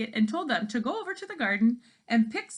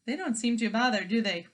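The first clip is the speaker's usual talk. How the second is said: usual speaking voice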